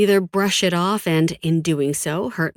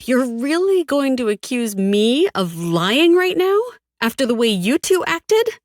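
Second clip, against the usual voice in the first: high, incredulous accusation with swooping pitch; scornful disbelief; 8 semitones higher, 4 dB louder than usual. A narrator's reading. She answers the twins' accusation of lying, scornfully incredulous. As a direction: throw the accusation back, voice soaring in disbelief. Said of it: "A laugh bubbles out of me".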